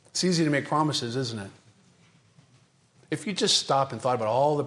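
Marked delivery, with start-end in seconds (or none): none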